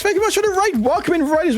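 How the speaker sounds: high, bright raid welcome; excited welcome to raiders; excited greeting. Real, not acted. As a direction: greet the raiders with a burst of energy.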